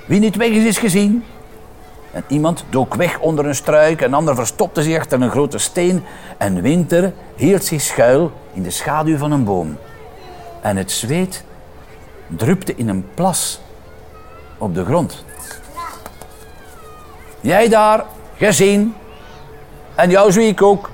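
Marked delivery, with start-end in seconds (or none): 0.1-1.2 higher, louder playground call. Acted. Boy calling the hiding game start to friends, loud call. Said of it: "riep een jongen". 17.4-20.9 higher and louder, calling out finds; seeker calling out. Acted. Seeker calling out to hidden children, loud triumphant call. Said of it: "riep de afteller".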